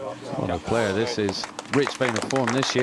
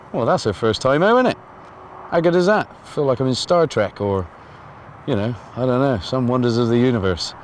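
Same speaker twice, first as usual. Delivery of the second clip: excited; 4 semitones higher, 6 dB louder than usual. Real, not acted. Thrilled after drone flight.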